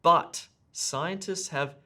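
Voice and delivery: loud, punched "But", set apart from what follows; stress on BUT. Shown as a demonstration. Teacher shows test takers the emphasised conjunction, stress on "But". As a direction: hit "But" hard, then slowly on. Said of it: "emphasized the conjunction but".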